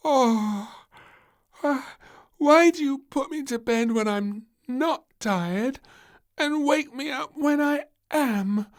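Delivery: put-on high, loud whiny little girl; sleepy demanding child. A narrator's reading. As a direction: demand it grumpily half asleep. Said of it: "demanded little Princess sleepily".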